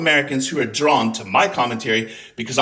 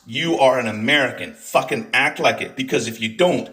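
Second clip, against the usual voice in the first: ordinary talk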